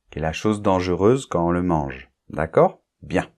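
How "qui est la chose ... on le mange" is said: usual speaking voice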